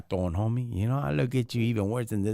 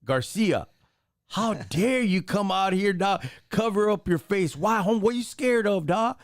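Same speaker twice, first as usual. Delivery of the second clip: higher, challenging street-tough voice; mock-confronting a masked agent, higher and heated; role play confrontation; 7 semitones higher than usual. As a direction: call him out like you're in his face. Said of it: "Let's role play".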